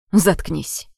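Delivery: a low, harsh order to shut up; angry snap. Acted. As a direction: cut her off hard. David snaps at her. (злобный окрик)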